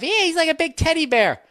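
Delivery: put-on high, loud girly gush; mock girl gush. Mocking girls calling the caller a teddy bear, high gushing voice. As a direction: mimic girls cooing over a sweet guy.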